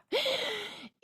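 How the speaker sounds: high, audible gasp; gasping inhale. Real, not acted. Teacher over-accentuating the breath, with a high gasp. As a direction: draw breath in sharply and audibly. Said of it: "It sounds like I'm gasping".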